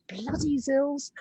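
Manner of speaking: slow, high mock grumble about the zills; drawn out, half-moaning the words; mock complaint. Guest playing a sore dancer, slow mock moan. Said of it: "that's exactly probably what they say".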